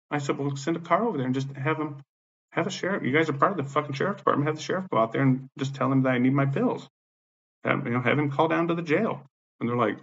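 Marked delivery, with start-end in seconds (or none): 0.4-9.2 higher-pitched, worked-up insisting; retold indignant demand. Inmate telling jail staff to call the sheriff, indignant. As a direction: plead your case, pitched up and exasperated.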